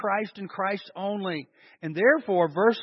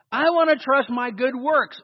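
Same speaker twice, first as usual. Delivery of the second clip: a whiny self-reliant objector, higher and louder; mock objector; 6 semitones higher, 7 dB louder than usual. Preacher voicing a congregant who trusts himself, mock protest. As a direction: play the stubborn believer who wants to trust himself.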